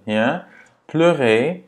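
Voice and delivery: normal talking voice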